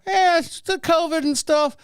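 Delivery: high, slow puppet excuse; Jeff Dunham-style dummy. Acted. Dead man's puppeteer makes excuses, high dummy voice. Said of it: "Jeff Dunham on him".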